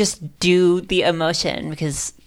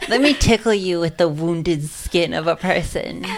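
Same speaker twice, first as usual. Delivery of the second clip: ordinary delivery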